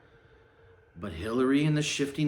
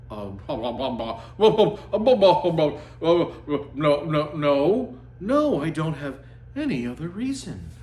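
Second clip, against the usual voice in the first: laughing, higher and louder babble of excuses; embarrassed mumbling; 4 semitones higher, 7 dB louder than usual. Acted. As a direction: babble it like sheepish knights.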